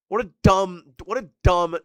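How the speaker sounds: annoyed yell; exasperated outburst. Real, not acted. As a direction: blurt it out in disgust. Frustrated about catching cold.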